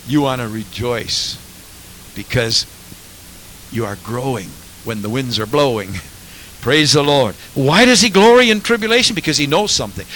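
ordinary talk, nothing marked

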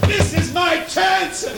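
high-pitched, stretched-out yell; defiant shout. Acted. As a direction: shout them off, desperate for the one chance. Bartimaeus fights off the hushing crowd.

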